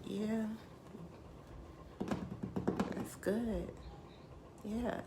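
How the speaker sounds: half-hearted agreement with long pauses; imitated lukewarm listener. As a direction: mimic a polite, disengaged response. Imitates listeners who cannot relate.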